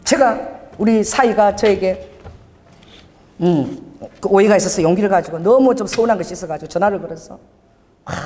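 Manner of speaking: usual speaking voice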